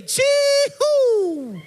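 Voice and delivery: high, loud Samoan cheehoo shout; Samoan cheehoo cry. Shown as a demonstration. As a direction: whoop it out like a festival cheer. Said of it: "If you're a tourist, say".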